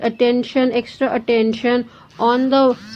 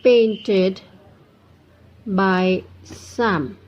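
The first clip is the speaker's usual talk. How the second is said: slow, long pause after every word; word-by-word dictation; much slower than usual. Shown as a demonstration. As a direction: read it out for students to copy down. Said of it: "teacher dictates example sentence".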